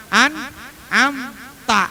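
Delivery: slow "An'amta", first "an", then "am" from the throat; separated syllables. Shown as a demonstration. Teacher drilling two letters, "An'amta" in separate held syllables. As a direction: split "An'amta" clearly and slowly. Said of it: "pertama an, kedua am".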